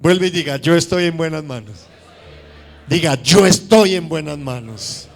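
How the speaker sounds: loud, fervent preaching; rousing sermon delivery. Acted. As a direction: preach it out to the congregation. Preaching to congregation at full force.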